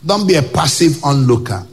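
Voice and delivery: normal talking voice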